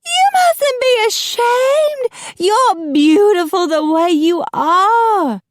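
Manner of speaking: very high, slow, loud melodrama; gasped dismay. A narrator's reading. Macy urges the couple not to hide, high theatrical gasp. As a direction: squeal drawn-out, impassioned encouragement. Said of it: "Macy gasped".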